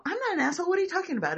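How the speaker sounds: high, loud mock-indignant denial. Accused friend denying it, high and indignant. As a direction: play the offended one who won't hear it.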